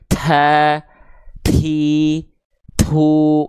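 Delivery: TA, TI, TU with an exaggerated puff of breath on the t; over-breathed ta. Shown as a demonstration. Tajwid teacher showing learners the overdone ta, ti, tu, over-aspirated. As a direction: overdo the breath burst on every t in ta, ti, tu. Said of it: "terlalu berlebihan si percikan nafasnya".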